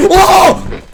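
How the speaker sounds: high sudden startled yelp; startled gasp. Real, not acted. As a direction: jump at it.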